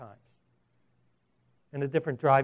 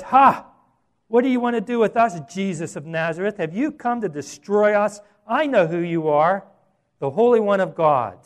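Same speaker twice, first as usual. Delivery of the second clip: loud, raised cry across a crowd; demon-possessed shout; 4 semitones higher, 8 dB louder than usual. Acted. Possessed man cries out in the synagogue at Jesus, shouting loudly. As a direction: shout it out like a challenge. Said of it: "he's shouting it as loud as he can".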